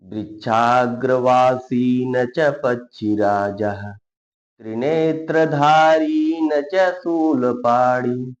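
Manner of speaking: slow, loud chanting recitation of the shloka; Sanskrit verse recitation. Acted. Teacher reciting a Sanskrit shloka to students, slow and loud. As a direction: intone each line slowly and loudly, like a recitation.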